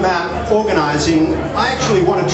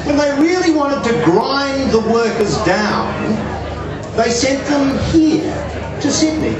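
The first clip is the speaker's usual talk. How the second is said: put-on higher, rising English-accented punchline; 7 semitones higher than usual. British friend delivering the joke in English accent. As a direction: build up to a high English punchline. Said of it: "in his quite genuine English accent".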